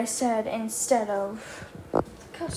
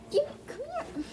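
high squeaky cooing; baby voice; 12 semitones higher, 6 dB quieter, much faster than usual. Acted. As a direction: coo at the pet up high. Cooing at the cat.

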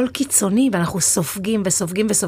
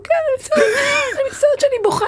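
much higher, choked retelling of her tears; retold crying apology; faster than usual. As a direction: replay the apology as if tearing up again. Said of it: "התחלתי לבכות עליו". (התנצלות בוכייה, בקול גבוה מאוד)

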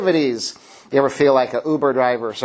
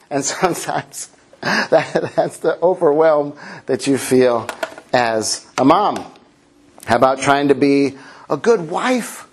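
normal talking voice

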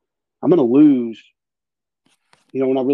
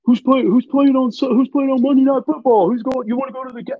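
mocking clueless fans; 10 semitones higher, faster than usual. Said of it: "people are like".

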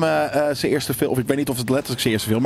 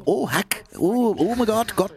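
high, exaggerated Minnesota accent; imitating a Minnesota mom, gasping; 10 semitones higher, slower than usual. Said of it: "die, die Minnesota".

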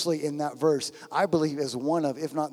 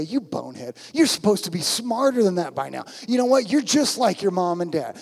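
mock scolding; 3 semitones higher, faster than usual. Preacher playing a Jesus who berates sinners, mock scolding. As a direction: scold as if digging up every old failing. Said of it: "Does he say".

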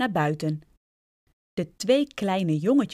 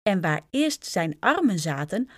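ordinary delivery, nothing marked

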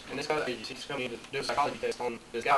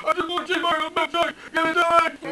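much higher and louder desperate wail; crying trapped man; 12 dB louder than usual. Acted. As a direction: beg like a trapped man breaking down. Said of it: "he comes out and he's crying".

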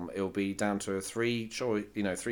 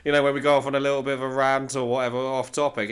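ordinary talk

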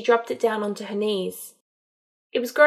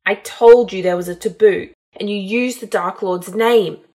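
loud, snarled rebuke at unraised pitch; angry snarl; 9 dB louder than usual. A narrator's reading. Man rebuking her for breaking the taboo, angry snarl. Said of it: "Malfoy snarled".

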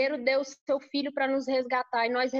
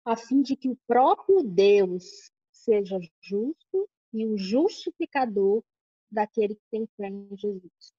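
próprio stressed, louder and lower; emphatic scripture reading; 5 semitones lower, 3 dB louder than usual. Shown as a demonstration. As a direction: lean hard on próprio. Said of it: "esse texto, pra mim, é, assim, singular".

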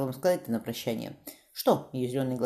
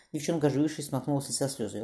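ordinary delivery

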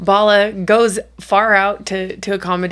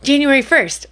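put-on higher, louder matter-of-fact kid; imitating daughter; 6 semitones higher, 4 dB louder than usual. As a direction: blurt the date up high. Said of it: "She goes".

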